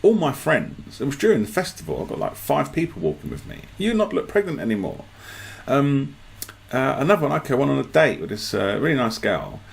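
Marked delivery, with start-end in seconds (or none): 3.8-5.1 repeated vendor line. Retold or imitated. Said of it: "Just in front of".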